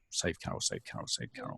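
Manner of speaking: desperate whispered plea; whispered prayer. Retold or imitated.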